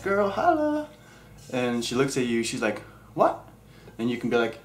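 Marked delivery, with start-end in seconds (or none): 0.0-0.9 pickup line. Pretend. Hitting on a girl, pickup line. 3.1-3.4 put-on girl voice. Girl's reply in skit.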